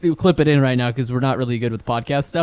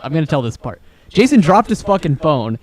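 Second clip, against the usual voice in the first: ordinary talk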